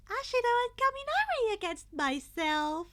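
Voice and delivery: high, loud chirpy announcing voice; cheerful principal. A narrator's reading. Principal announcing the teams to the class, high cheerful call. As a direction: announce it like a bubbly host.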